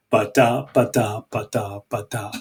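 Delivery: ba-da ba-da, rising beat, fading away; da-DUM rhythm demo. Shown as a demonstration. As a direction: tap out an iambic beat with your voice. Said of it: "does it go".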